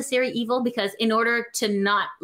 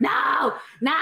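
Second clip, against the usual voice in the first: a sudden shouted no, no; half-joking inner yell; mock inner shout; 4 semitones higher than usual. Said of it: "Inside, you're like".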